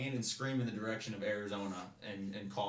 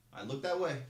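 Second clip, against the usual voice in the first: drill instructor order; 6 semitones higher, 6 dB louder than usual. Retold or imitated. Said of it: "The guy goes".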